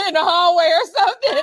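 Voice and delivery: high, loud words through laughter; laughing out loud mid-sentence; real laughing. Real, not acted. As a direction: crack up while finishing the joke. Laughing through joke about tripping student.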